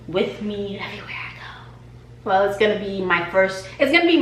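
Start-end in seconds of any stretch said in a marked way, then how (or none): 0.1-1.7 softly whispered, fond; whispered promise. Real, not acted. Vlogger vowing the chair stays with her, whispered. Tender vow about chair.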